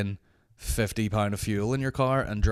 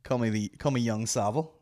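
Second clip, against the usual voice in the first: usual speaking voice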